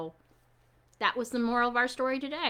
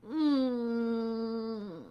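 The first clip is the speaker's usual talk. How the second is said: mock sad whimper; 5 dB quieter than usual. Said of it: "So how do we act sad?".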